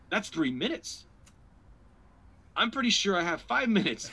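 high, rising disbelief; dismayed realisation. Retold or imitated. Comic learning his set is shorter, high-pitched dismay.

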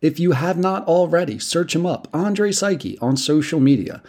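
loud, upbeat announcer read; podcast outro. Acted. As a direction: hype the plugs like a host.

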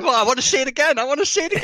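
hammed-up high-pitched pleading; searching camera voice. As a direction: squeal it as the hunting autofocus. Playing along as the searching camera.

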